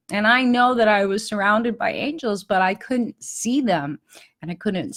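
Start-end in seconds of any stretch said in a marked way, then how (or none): none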